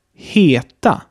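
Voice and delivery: Heta with a long, closed E as in Mehl. Shown as a demonstration. Teacher models Heta for learners, exaggerated long E. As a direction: draw out the first vowel of Heta, Swedish style. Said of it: "die Vokale länger sprechen".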